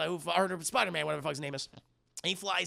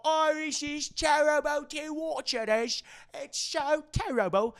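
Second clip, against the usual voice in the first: high, slow put-on woman's voice; over-dramatic horrified reporter impression; mock TV reporter; 11 semitones higher, 4 dB louder, slower than usual. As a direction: draw it out high, in hammed-up dismay. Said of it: "And then we have Jessica".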